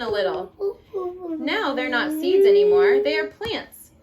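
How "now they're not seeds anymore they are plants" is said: higher and louder reveal; excited reveal. Acted. Mom reading the seeds becoming plants, excited. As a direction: make it a big moment.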